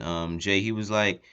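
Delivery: usual speaking voice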